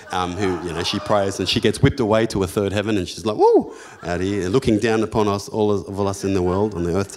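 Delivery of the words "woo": high, loud swooping woo; mock rapture woo. Pastor mocking a praying woman swept away, high loud whoop. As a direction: whoop like you're lifted to heaven.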